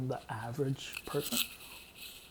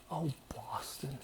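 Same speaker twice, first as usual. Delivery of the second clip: whispered reply; 10 dB quieter, slower than usual. Retold or imitated. Roommate whispering where he's headed. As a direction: whisper it, almost no voice. Roommate answering while leaving.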